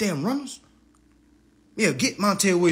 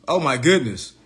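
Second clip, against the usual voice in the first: normal talking voice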